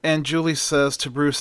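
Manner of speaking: usual speaking voice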